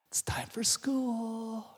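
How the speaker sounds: slow, sing-song hushed wake-up; soft parent's morning coo; gentle wake-up whisper. Acted. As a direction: coax a sleepy child softly, drawing it out. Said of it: "how you wake up your kids in the morning".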